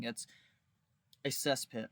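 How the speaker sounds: normal talking voice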